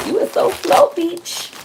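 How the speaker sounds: high-pitched playful self-teasing; mock scolding. Vlogger ribbing herself for being slow, high mock scolding. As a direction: tease in a squeaky-high mock reproach.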